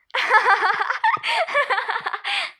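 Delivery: loud, high-pitched laughing fit; high giggling burst. Acted. Friends laugh at the scolding. (tiz, yüksek kahkaha)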